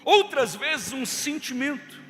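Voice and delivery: rising fervent preacher; building preaching. Real, not acted. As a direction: build it up like a sermon.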